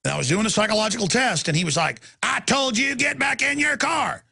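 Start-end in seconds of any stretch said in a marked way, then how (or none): 2.2-4.2 high mock order, slowing on the last words; retold cop yell. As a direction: cop ordering him back to his car, mild mock yell. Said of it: "You don't have to yell at me".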